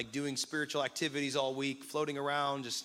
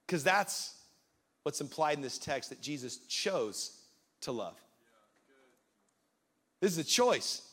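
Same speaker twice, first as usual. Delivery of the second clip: ordinary delivery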